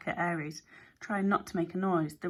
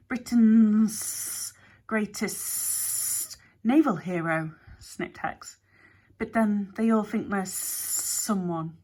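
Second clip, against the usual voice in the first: slow, whispery drawn-out snake; a sly snake confessing at half speed; snake Hex, confiding; 3 semitones higher, 5 dB louder, much slower than usual. A narrator's reading. Snake Hex admitting the secret to Alex, slow and sly.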